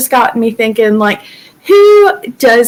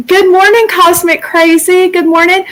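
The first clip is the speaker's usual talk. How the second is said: bright greeting; 7 semitones higher than usual. Real, not acted. Greeting viewers in live chat.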